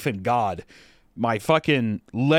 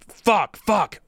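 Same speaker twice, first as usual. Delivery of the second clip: angry curse; 8 semitones higher, 5 dB louder than usual. Retold or imitated. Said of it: "more angry than anything".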